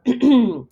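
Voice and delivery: loud important throat clear. Acted. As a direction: clear your throat before a speech. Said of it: "cleared his throat".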